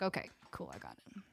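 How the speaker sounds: a quiet satisfied okay, trailing off; relieved mock murmur. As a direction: mutter it like it finally clicks. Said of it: "I'd be like".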